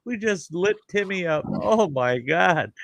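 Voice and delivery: very high-pitched mock alarm; mock panic. Onlookers seeing Timmy lit up, mock panic. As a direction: exclaim it high, hammed-up horror. Acts onlookers as friend catches fire.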